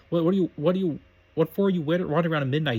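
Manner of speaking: plain reading voice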